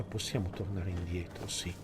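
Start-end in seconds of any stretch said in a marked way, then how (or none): none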